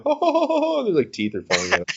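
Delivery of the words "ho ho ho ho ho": high, loud, giddy ho-ho laugh; mock childish chortle; kid laughing. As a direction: laugh like a gap-toothed kid running off. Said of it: "And they're like".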